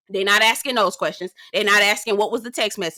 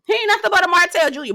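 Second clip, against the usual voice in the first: higher, louder and faster on the repeat; emphatic repeat; 9 semitones higher, 5 dB louder, faster than usual. Shown as a demonstration. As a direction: punch it home the second time, high and quick. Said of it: "But, girl, he ain't nothing but a Martell Junior".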